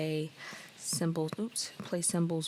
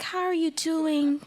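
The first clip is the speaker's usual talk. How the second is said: squeaky, much higher voice for the Sim's greeting; high-pitched voice; 11 semitones higher, 4 dB louder, slower than usual. Acted. Lauren greeting a retirement home resident, squeaky high voice. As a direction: pitch the greeting way up, slow and sing-song. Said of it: "Lauren doesn't give high-pitched voice".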